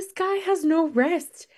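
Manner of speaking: high-pitched mock yell at the TV; mild, incredulous retold yell; retold exasperated yelling. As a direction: protest to the TV without really shouting. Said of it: "yelling at the T V".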